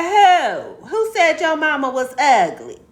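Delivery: high, loud imitated outrage; mock-outraged little boy; indignant boy. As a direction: demand it like a kid defending a friend. Retold boy demanding who insulted her.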